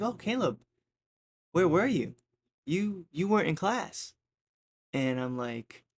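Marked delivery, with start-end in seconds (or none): 0.0-4.1 high, pointed teacher voice, slowing at the end; a hint of a suspicious teacher; imitating the teacher. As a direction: play the sculpting teacher who already suspects. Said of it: "the way she was talking".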